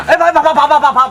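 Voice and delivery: high, loud stuttered call; urgent stammer. Acted. Villager stops sister-in-law from leaving, urgent loud stammer. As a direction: stutter loudly to stop someone.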